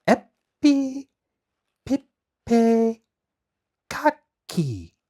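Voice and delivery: high, loud spell words, spaced apart; high, loud magic words read aloud. Acted. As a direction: chant the magic words one by one. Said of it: "she read it aloud".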